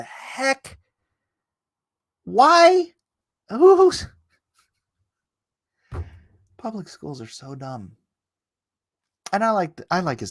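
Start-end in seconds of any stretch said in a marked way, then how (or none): 0.0-4.1 much higher, disbelieving; high-pitched baffled outcry; incredulous squeak. Real, not acted. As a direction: yelp the question. Exasperated outburst.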